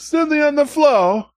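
mock-horrified passenger; imitating the passenger. Passenger reacting to the toilet mess, mock-horrified high voice. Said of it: "He was".

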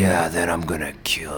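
whispered low action-hero line; breathy mock Vin Diesel. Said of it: "let's do it at the same time".

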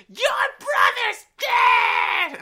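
high, loud, drawn-out wail of a crying mother; mock scream with a sob in it; imitating screaming mom. Retelling mom's phone call, imitating her high loud wail. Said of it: "she calls me screaming and crying".

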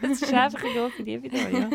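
laughing while talking. Real, not acted. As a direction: laugh through the words. Agrees, laughing at shared phrase.

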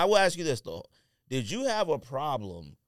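normal talking voice

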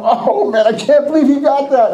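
high-pitched mock dismay; envious inner groan. Envious friend's thought behind the congratulations, dismayed. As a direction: moan the secret thought, played for laughs. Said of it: "Inside I'm going".

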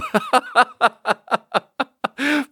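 very high, rapid cackling laugh; long high giggle. Real, not acted. Laughing at buddy's breakup story, long high giggle. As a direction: crack up helplessly at the punchline.

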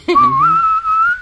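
high, held whistling flute note; pan flute imitation. Player scores the falling kimono, high flute imitation. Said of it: "with a pan flute".

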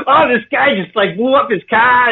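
high, exaggerated Boston accent; heavy Boston accent. Guest imitating people on the news, heavy Boston accent. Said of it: "like, the heavy, like".

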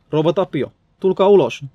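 demanding call to come out. A narrator's reading. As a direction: shout to someone behind a door. Calls through a blocked door.

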